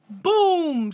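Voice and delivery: loud, high, drawn-out impact word; explosive boom. Retold or imitated. Boasting that she hit her in the face, explosive boom. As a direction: shout the knockout with relish. Replaying the punch, triumphant.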